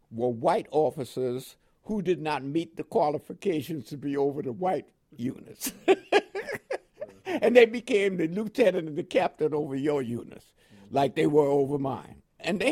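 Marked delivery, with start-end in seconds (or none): 5.8-6.8 a short high burst of laughter; real amused laugh. Real, not acted. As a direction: laugh at the absurdity. Laughing at racist officer system.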